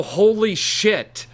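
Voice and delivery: slow, high, stunned holy shit; drawn out in awe; amazed exclamation. Retold or imitated. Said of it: "made me go".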